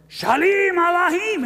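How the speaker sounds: put-on high, loud, strained old rabbi voice; hammed-up ancient rabbi telling a parable. Ancient rabbi tells the kids a parable, quavering and loud.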